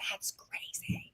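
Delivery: faint, slow whisper. Retold or imitated. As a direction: breathe it out, barely voiced. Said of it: "She's going".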